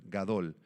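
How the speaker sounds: gadol said just as it is spelt, ga-DOL; Hebrew word said carefully. Shown as a demonstration. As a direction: spell out gadol clearly by its sounds. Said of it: "así como suena". (gadol pronunciado tal como suena)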